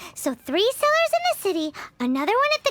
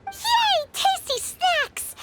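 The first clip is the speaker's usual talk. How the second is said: delighted squeal; 9 semitones higher, much slower than usual. Acted. Paimon delighted by snacks.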